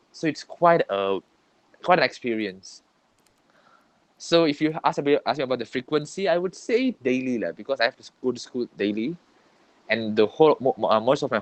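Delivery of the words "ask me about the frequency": ordinary talk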